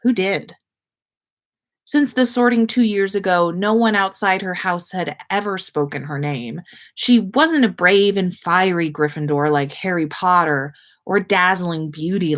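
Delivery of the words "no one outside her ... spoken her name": usual speaking voice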